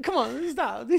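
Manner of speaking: high, giggling plea to stop; laughing plea. Retold or imitated. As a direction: beg him to stop while cracking up. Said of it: "I literally started going".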